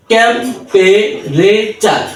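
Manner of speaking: "টেম্পেরেচার" broken slowly into syllables; ভেঙে ভেঙে উচ্চারণ. Shown as a demonstration. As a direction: sound out "টেম্পেরেচার" piece by piece, with gaps. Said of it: "এইভাবে syllable ভেঙে ভেঙে". ("টেম্পেরেচার" syllable ভেঙে ধীরে ধীরে)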